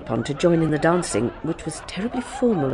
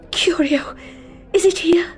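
eager whisper; 11 semitones higher than usual. Acted. Lady's eager whispered question.

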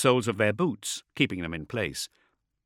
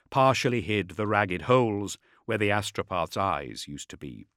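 plain reading voice

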